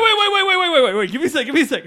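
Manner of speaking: high, loud rapid waits; urgent shouting. Real, not acted. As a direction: shout to stop him talking. Cuts off friend's verdict urgently.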